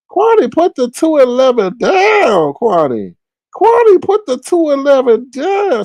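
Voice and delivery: high, loud hollering at Kwani; mock screaming. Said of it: "She over there screaming and yelling".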